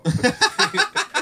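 real laugh, squeaked out high; cracking up at the punchline; delighted cackle; high burst of laughter. Real, not acted. Laughing at his own story.

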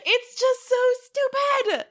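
high, drawn-out cry of disbelief; delighted exasperation. Real, not acted. Fan reacts to swimming in fountain, high delighted exasperation. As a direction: stretch it out high, loving the absurdity. Delighted at absurd film gag.

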